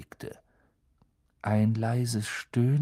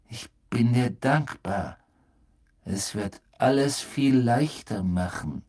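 slow, eerie, louder menace; menacing gaunt man; 3 semitones higher, 3 dB louder than usual. Acted. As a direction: loom over him, savouring it.

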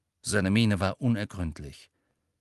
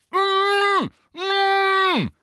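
sehr hohes, lautes Mm; geknebeltes Rufen; 8 dB louder than usual. A narrator's reading. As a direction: schrei mit geschlossenem Mund gegen den Knebel an. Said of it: "rief Ron durch seinen Knebel". (laute, hohe, geknebelte Protestlaute)

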